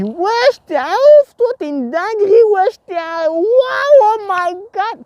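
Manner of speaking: very high, loud, hammed-up shocked woman; exaggerated outcry. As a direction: overplay her outrage for the camera. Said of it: "là, j'exagère parce que je suis youtubeur". (imitation exagérée, très aiguë et forte)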